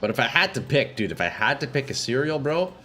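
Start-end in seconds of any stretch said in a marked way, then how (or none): none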